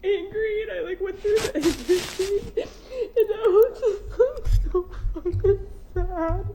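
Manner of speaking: real tearful sobbing with broken laughs; fighting through tears while venting; crying. Real, not acted. Crying while telling of betrayal.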